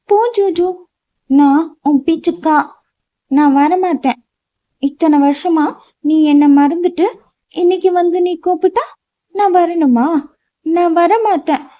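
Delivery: put-on a small girl sulking at her uncle; sulking little girl. A narrator's reading. Girl sulks in dream. (உயர்ந்த குரலில் கோபித்துக்கொள்ளும் சிறுமி)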